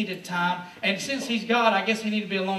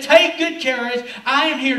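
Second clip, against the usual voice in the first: commanding call; 3 semitones higher, 8 dB louder than usual. Acted. Jesus calls out over the storm.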